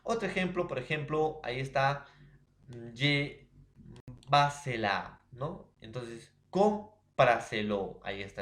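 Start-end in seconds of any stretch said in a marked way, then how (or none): 4.3-5.2 "llévasela" con la primera sílaba, LLÉ, fuerte; acento en LLÉ. Shown as a demonstration. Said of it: "es tras antepenúltima sílaba". 6.5-8.0 "cómpraselo" lento y fuerte, golpeando CÓM; acento en CÓM. Shown as a demonstration. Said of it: "es tras antepenúltima sílaba".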